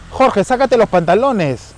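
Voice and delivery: high, loud, slow motherly order; imitated mother's command. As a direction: imitate a mother ordering her son, drawn out. Dead mother's command, retold. (orden de madre imitada, aguda y lenta)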